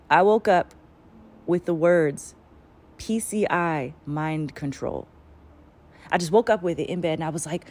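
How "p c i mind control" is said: each word slowly spaced; slow deliberate reading. Retold or imitated. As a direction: spell it out slowly.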